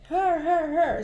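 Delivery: high, loud "her" repeated; excited pointing. Retold or imitated. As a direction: call out to point at her friend. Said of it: "the girl next to me says".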